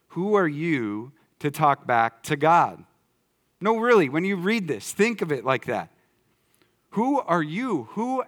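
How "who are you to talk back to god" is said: slow rebuke. Acted. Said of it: "Verse twenty is basically saying".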